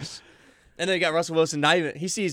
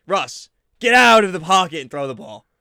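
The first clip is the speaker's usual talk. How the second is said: much louder exasperated yell at the quarterback; fan yelling; 3 semitones higher, 9 dB louder than usual. Real, not acted. Fan shouts at Russell Wilson on TV, furious yell. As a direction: bellow at the TV screen.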